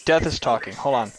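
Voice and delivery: ordinary delivery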